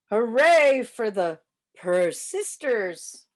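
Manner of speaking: ordinary delivery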